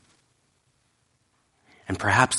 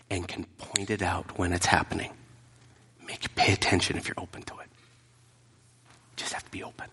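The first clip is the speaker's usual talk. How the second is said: hushed preaching; 3 semitones lower, 9 dB quieter than usual. Real, not acted. Hushed close of point.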